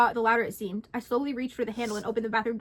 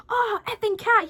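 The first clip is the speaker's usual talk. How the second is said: high, loud, slow scream; pained scream; 10 semitones higher, 6 dB louder, slower than usual. Acted. Man attacked by the cat screams in pain. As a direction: yelp as the cat claws. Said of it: "he screamed".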